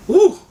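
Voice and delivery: short, high, loud "woo"; excited whoop. Real, not acted. Vlogger admiring his sneakers, excited whoop. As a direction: let out a sudden high whoop.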